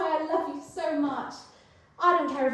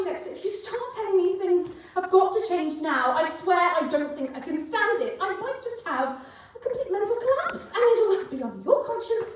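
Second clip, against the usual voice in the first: hysterical rant; 3 semitones higher, 5 dB louder than usual. Acted. Rising hysterical rant about wedding.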